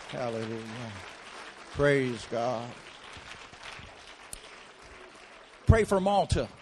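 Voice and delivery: loud, fervent preacher's prayer; preaching prayer. Acted. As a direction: lift the whole room into praise. Leads fervent prayer and praise.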